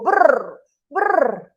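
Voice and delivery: loud rolled brrr; shivering brrr. Acted. Storyteller as monkeys so cold in rain, shivering. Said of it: "the monkeys would be so cold".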